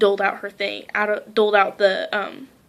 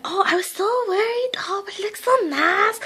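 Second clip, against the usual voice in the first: put-on much higher, light girlish voice; 10 semitones higher than usual. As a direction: play the small hairdresser, pleased.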